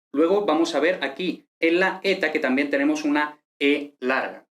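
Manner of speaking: ordinary talk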